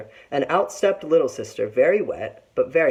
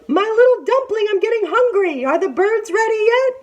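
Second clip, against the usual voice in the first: very high, loud sugary call; calling sweet auntie; 5 dB louder than usual. A narrator's reading. Tiger to Big Sister up the tree, sweetly impatient. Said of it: "Auntie Tiger shouted".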